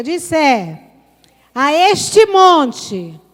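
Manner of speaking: "este monte" drawn out very slowly and loudly; stress on este monte. Shown as a demonstration. Preacher has the church repeat, stressing "este monte".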